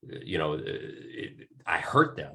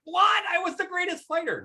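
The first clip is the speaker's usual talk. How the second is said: squeaky high shocked What, then whining much higher; mock shocked disbelief. Fighter who lost, to the coach, in high mock disbelief. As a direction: play the stunned loser who was told he was the greatest. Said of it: "And they're like".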